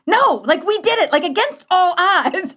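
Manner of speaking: high, excited and a little breathless; retold thrill at getting married; giddy disbelief. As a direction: insist with amazed glee, laughing.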